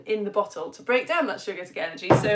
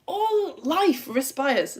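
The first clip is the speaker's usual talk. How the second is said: slow, higher, wondering emphasis; awed; 5 semitones higher, much slower than usual. Real, not acted. Teacher marvelling at a science fact, slow. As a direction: savour the amazing fact.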